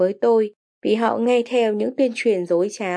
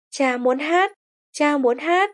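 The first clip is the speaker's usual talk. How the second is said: a lightly voiced, higher burst of joy; mild happy excitement, pitched higher; joyful exclaim; 5 semitones higher, slower than usual. A narrator's reading. Father overjoyed at his daughter's exam result, mildly excited and higher. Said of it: "nhảy cẫng lên vui sướng".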